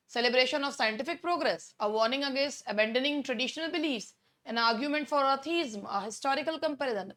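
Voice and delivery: slow, soft reading of exam options; measured quiz-reading voice; reading options aloud. Acted. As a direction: lay out each answer choice slowly. Reads answer options slowly.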